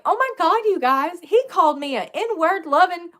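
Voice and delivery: high, pearl-clutching outrage to the audience; mock shocked. Storyteller telling viewers what he called her, high and aghast. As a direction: squeal it as if scandalized by an insult. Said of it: "I was clutching my pearls".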